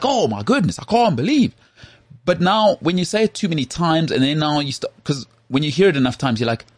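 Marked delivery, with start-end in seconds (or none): 0.0-1.5 shocked gasp. Retold or imitated. Mock disbelief quote about celebrities.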